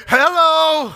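loud, high, drawn-out hello; emphatic quoted hello. Retold or imitated. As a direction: call out a big incredulous hello. Quoting a father's exclamation.

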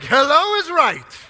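loud, high, stretched-out exclamation; slow, high, punchy shout; emphatic agreement. Retold or imitated. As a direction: belt it out in heartfelt agreement. Preacher echoing the exclamation emphatically.

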